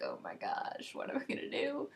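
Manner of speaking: breathy hushed little freak-out; a hushed, half-joking panic; mock panic. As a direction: play at panicking under your breath. Mock panic after awkward confession.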